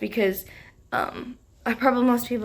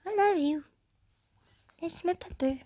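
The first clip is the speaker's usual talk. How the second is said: higher cooing pet voice; 9 semitones higher than usual. Real, not acted. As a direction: coo lovingly at the puppy. Cooing at her pet dog.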